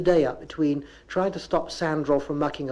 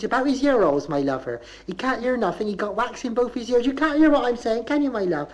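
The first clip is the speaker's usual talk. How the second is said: high rising Bristol mother's voice; 7 semitones higher than usual. Retold or imitated. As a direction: lift every line up like a question. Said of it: "She said".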